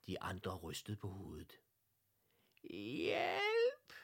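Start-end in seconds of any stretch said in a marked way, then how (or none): 2.6-3.9 very high, drawn-out squeal for help; louder faint cry. A narrator's reading. Unseen voice calling so all hear, louder cry. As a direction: push the tiny plea out harder. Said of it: "lød det igen lidt højere".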